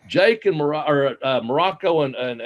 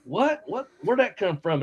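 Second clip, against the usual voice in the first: high, startled double take; hearing a strange remark out of nowhere, surprised and high; baffled surprise; 8 semitones higher than usual. Retold or imitated. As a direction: jump up high in disbelief. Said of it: "And I was like".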